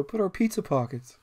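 high, plaintive little voice from across the room; distant overheard voice. Acted. Third villain in the distance bemoaning lost snacks, high plaintive voice. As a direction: pitch it up, as if from further off. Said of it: "another one kinda in the distance go".

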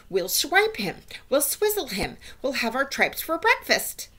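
high, excited witches' outcry; bloodthirsty clamour. Acted. Audience of witches clamours for revenge.

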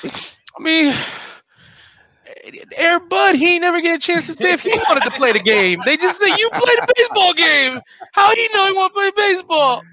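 breaking into tearful yelling; voice cracking. Pretend. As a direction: choke up over Air Bud, half joking. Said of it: "My voice just cracks".

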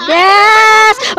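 high, loud drawn-out yes; exultant yell; excited cheer. Real, not acted. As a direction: shout it in delight. Cheering reply to companion.

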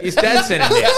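very high, loud laughing words; laughing hard. Real, not acted. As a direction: shriek it through laughter. Laughing punchline.

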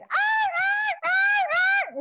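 high, piercing puppy wails; puppy crying. Retold or imitated. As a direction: wail like a distressed puppy. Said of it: "it is crying".

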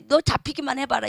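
ordinary delivery

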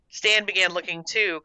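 ordinary delivery, nothing marked

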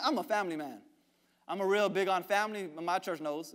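ordinary talk, nothing marked

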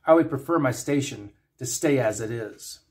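plain reading voice

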